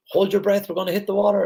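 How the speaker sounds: high, urgent call before the splash; excited call on a waterslide; excited warning. Retold or imitated. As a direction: shout it to your son as the ring drops. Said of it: "I was like".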